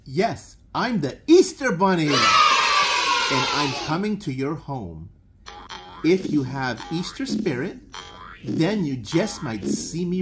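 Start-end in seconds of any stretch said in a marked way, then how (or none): none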